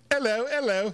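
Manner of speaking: a deliberately bad English accent. Podcast host jokingly greeting his co-host Gaz in a bad English accent. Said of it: "my really bad, bad English accent".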